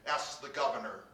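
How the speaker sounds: usual speaking voice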